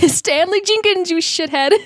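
laughing, loud and high; laughing scold. Real, not acted. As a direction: shout the name through laughter.

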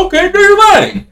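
very high, loud put-on squeal; mock compliance. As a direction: pretend to give in, absurdly high-pitched. Mock meek reply to a threat.

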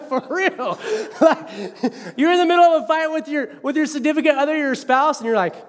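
ordinary talk, nothing marked

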